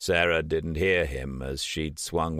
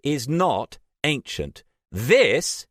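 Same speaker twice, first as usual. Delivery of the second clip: This punched higher and louder; emphatic; 9 semitones higher, 5 dB louder, slower than usual. A narrator's reading. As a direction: stress This hard. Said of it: "emphasizing the word".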